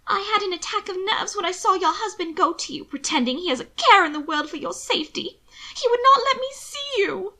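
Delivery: high, overwrought sister's voice; distraught. A narrator's reading. Sister accuses her brother's husband, high and distraught. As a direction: climb to a high, upset break-off. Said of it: "She cut off".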